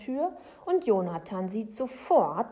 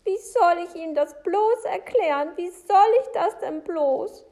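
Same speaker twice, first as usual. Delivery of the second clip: high, loud wail of despair; distraught Frau Segebrecht; 11 semitones higher, 11 dB louder than usual. Acted. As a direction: wail it helplessly, pitched well up.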